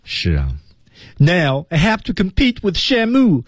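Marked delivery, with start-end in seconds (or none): 1.2-3.4 high, drawn-out mock whine; slightly tearful. Teacher acts Alex stuck competing with Shamu, slightly tearful. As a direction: act it as a worried complaint on the edge of tears. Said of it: "就要让你知道".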